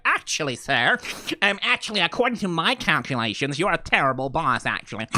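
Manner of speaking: imitated fussy nerd correcting someone; nerdy straight man. Nerd correcting his boss, mock know-it-all voice. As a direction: put on a pedantic nerd voice. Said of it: "Like, he's the nerd".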